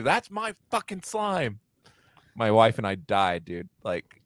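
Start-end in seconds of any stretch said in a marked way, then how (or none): 0.0-1.6 high-pitched imitation of an indignant child; mock a little kid's foot-stomping tantrum; stomping four-year-old. Dad retelling daughter's slime outburst, high indignant child voice. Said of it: "She stomped her foot when she said".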